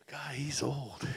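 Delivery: whispered aside. Retold or imitated. Speaker voicing the audience's thought, a whisper. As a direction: whisper what the crowd thinks. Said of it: "I just said what you're thinking".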